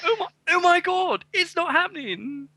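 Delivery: high, squealing Essex accent in mock alarm. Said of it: "you do a better Essex voice than me".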